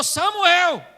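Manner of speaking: high, loud divine summons; God calling. Acted. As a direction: call the name out insistently, as God. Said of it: "Aí depois Deus falou".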